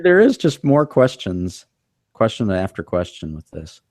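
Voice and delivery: ordinary talk, nothing marked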